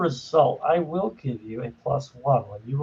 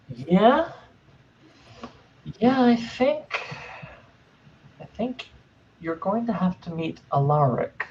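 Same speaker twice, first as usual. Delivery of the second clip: creature voice; 4 semitones higher than usual. Acted. GM voicing Gribblegast.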